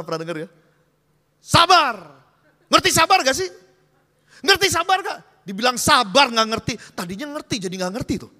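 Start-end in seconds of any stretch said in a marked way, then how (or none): 1.4-5.2 bentakan keras tak sabaran; menyuruh sabar dengan tidak sabar. Acted. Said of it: "suruh kita sabar dengan tidak sabar". 5.5-6.7 omelan keras tak sabaran; menyuruh sabar secara barbar. Acted. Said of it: "dia suruh kita sabar secara barbar".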